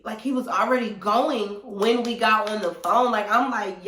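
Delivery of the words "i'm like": ordinary talk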